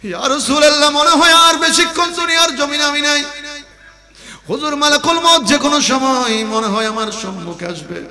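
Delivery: high, loud chanted lament; high intoned sermon cry, easing down at the end; impassioned preaching. Acted. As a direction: chant the dying wife's plea at full force. Preacher voices the dying wife.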